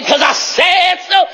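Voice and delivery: high, drawn-out declaration; yelling. Real, not acted. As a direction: yell it slowly, every word stretched. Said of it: "me yelling".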